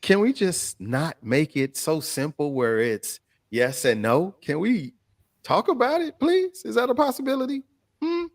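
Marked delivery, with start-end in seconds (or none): 4.4-8.2 high-pitched coaxing request; squeaky little plea; pleading. Real, not acted. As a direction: ask sweetly, pitched way up. Mock plea to the panel.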